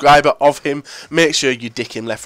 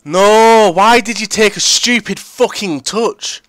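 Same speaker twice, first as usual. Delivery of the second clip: high, loud drawn-out protest; frustrated outburst; 8 semitones higher, 5 dB louder, slower than usual. Real, not acted. Gamer yelling at his player over a bad touch, frustrated outburst. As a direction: cry out in exasperation at the play.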